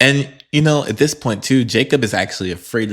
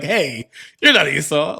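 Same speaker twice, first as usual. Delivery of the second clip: imitating Isaac; 5 semitones higher than usual. Voicing Isaac discovering deception.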